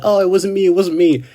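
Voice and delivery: high, loud mock panic; freaking out. A fan retells Amelia denying blame, mock freaking out. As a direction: imitate a panicked denial, pitched well up. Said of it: "freaking out".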